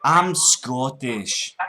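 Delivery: very slow, drawn-out Scots; broad Scottish accent. Pretend. Prank caller repeating to scammer, broad Scottish. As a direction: stretch it out as a put-on Scot.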